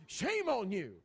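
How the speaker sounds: "Shame on you" scolded high and loud, stress on "Shame"; scolding mom. Retold or imitated. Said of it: "your mom ever look at you".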